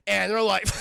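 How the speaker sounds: normal talking voice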